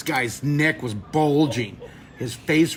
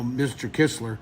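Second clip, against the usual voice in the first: normal talking voice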